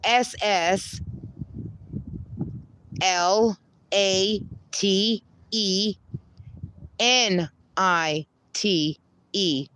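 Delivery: slow, letter by letter spelling; careful slow spelling; spelled slowly. Shown as a demonstration. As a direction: spell each letter out clearly. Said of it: "Everything else is lowercase".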